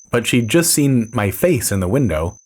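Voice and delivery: plain reading voice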